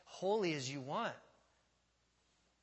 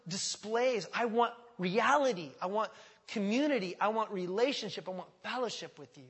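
loud, raised, insistent declaration; God's impassioned plea; 5 semitones higher, 7 dB louder than usual. Acted. Preacher speaking as God seeking relationship, impassioned appeal. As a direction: press each want with fervor, easing at the end. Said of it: "The idea is".

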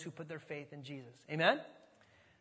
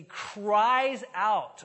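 louder, drawn-out emphasis; emphatic cry; 3 semitones higher, 10 dB louder than usual. Shown as a demonstration. Preacher stressing how Jesus spoke, loud drawn-out emphasis. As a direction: stretch it out and push the volume. Voicing the verb he explains.

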